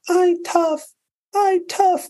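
put-on tiny, very high toddler voice; imitated crying baby. Baby who fell down copying mom, mock tearful squeak. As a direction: mimic a tearful one-year-old repeating after mom. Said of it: "And the baby goes".

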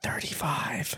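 whispered aside; breathy hushed whisper. Pretend. Whispering along in the skit.

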